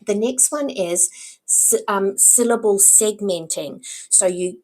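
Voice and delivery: usual speaking voice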